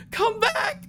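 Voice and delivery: thin falsetto yell after someone leaving. Acted. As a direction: call out in a very high voice, held back in volume. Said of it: "You can hear her yelling".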